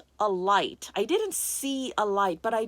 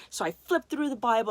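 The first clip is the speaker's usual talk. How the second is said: usual speaking voice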